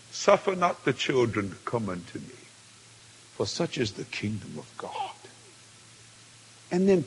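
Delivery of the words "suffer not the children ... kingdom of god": low, hushed, measured scripture voice; solemn divine pronouncement. Retold or imitated. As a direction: sink low and soften towards the end. Quotes the Lord in vision.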